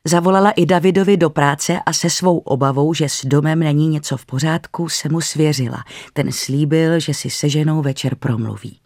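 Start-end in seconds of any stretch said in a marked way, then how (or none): none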